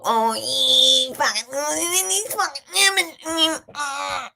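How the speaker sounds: high, loud, garbled mumble of a bushman; bushy farmer dad. Retold or imitated. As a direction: imitate an unintelligible bush farmer, words smearing into noise. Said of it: "it's more like".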